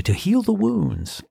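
high, slow, hammed-up DJ line; mock radio DJ. Shown as a demonstration. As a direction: overdo the on-air DJ croon. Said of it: "Boy, I sounded like a radio D J".

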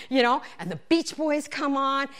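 normal talking voice

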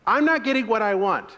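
high, loud admission; loud confession. Shown as a demonstration. Pastor showing the honest answer in a quarrel, loudly. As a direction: own it out loud. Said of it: "And then they get to go".

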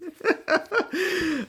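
short high-pitched giggle; high laugh. Real, not acted. Host laughing at her own hypocrisy, high giggle.

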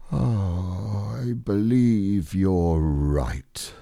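slow, drawn-out sighing resignation; long sad sigh. A narrator's reading. Donkey admits his tail is gone, sighing long and sadly. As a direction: let the words stretch out on a mournful sigh. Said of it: "with a long, sad sigh".

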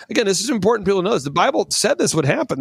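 ordinary delivery

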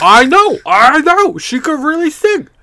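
very high, loud mock-dad squeal; amazed American dads. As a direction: cry out in hammed-up disbelief and delight. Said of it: "all the dads in America went".

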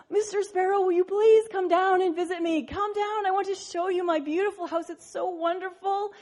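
high little frog voice, pleading. Acted. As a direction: coax the sparrow down, sweetly. Frog begs sparrow to visit.

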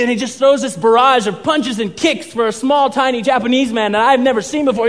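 ordinary delivery

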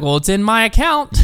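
high mock granny retort; lightly voiced old lady standing firm; imitated old grandma. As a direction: answer back in a high grandma voice. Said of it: "sounded like an old grandma".

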